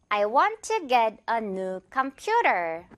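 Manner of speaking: very slow repeat with PU of computer stressed; again slowly, com-PU-ter; slow model sentence. Shown as a demonstration. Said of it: "퓨 부분에 강세 주면서". (천천히 다시, 퓨에 강세)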